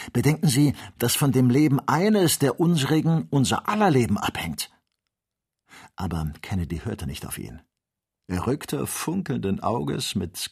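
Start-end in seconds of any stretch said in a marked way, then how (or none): 0.1-4.7 higher, louder, insistent plea; urgent warning. A narrator's reading. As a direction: implore him to hold back. Joe pleads before lion attack. (eindringlich und laut warnend)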